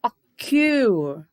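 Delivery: "O Q" with a long, loud Q; stress on final syllable. Shown as a demonstration. Teacher proving which syllable is stronger, heavy final stress. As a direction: stretch and punch the Q in "O Q". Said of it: "a sílaba mais forte é o Q".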